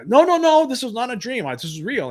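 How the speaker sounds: high, loud protest that settles down; retold pleading insistence, starting loud and high; insisting. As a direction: insist hard, as if disbelieved. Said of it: "trying to insist".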